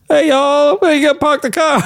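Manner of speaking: put-on Southern accent.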